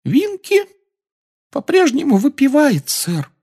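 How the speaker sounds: very high sorrowful squeak; sad elf squeak. Acted. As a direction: squeak it out sadly. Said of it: "печально ответствовал эльф".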